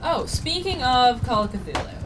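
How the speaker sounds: ordinary delivery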